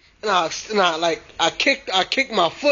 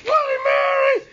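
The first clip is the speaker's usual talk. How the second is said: screaming in fright; much slower than usual. Retold or imitated. Boy fleeing from Bloody Mary, screaming in terror. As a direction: shriek her name as you flee. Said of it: "And I ran".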